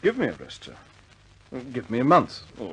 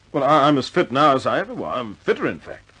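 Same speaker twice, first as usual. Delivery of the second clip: raised, louder, pressing his case; urgent protest; 5 semitones higher, 7 dB louder than usual. Acted. Pilot protesting to his commander, urgent and raised. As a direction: fight to keep flying, voice climbing. Gibson protests being grounded.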